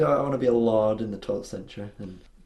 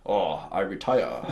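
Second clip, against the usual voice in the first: slow mock Japanese accent; 3 semitones lower, slower than usual. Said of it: "That is a brilliant Japanese accent".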